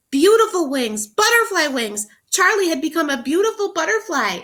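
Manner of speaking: high, loud, thrilled read-aloud; excited reading. Shown as a demonstration. Teacher reading to children, thrilled high read-aloud. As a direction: burst out with delight at the reveal. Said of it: "The author wants you to be so excited".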